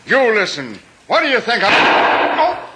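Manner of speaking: rising furious retort cut by a cry; angry outburst. Acted. Islander rages at the skipper and is shot, furious. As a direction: snap back, then a sudden cry.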